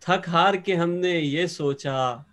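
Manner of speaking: slow, drawn-out verse delivery; Urdu poetry recitation. Acted. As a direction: recite the couplet line by line to an audience. Poet recites couplet once more.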